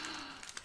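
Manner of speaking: sharp gasp of delight. Real, not acted. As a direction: gasp at something beautiful. Sees the yarn colour.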